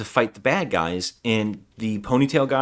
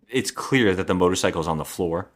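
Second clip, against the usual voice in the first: normal talking voice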